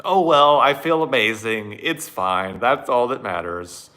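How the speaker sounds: high, loud mock-blissful brushing off; giddy denial. Mocking his blissed-out self brushing off the clogged sink, giddy. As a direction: gush like nothing could be wrong.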